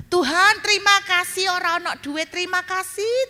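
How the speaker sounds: high, loud, thankful outburst to God; reliving a prayer of relief; grateful cry. Retold or imitated. Retold grateful prayer, high pitch.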